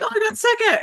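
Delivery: high, chirpy mock pride; high sing-song mock cheer; mock proud competitor. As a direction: play the clueless proud one. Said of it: "they're like".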